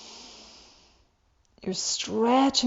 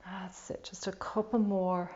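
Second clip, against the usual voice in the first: guided meditation; 3 semitones lower, 7 dB quieter than usual. Acted. Leads a guided breathing meditation.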